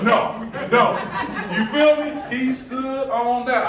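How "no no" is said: loud, laughing double no; stubborn father laughing off the idea; laughing firm no. Retold or imitated. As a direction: shut it down with a laugh. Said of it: "He was like".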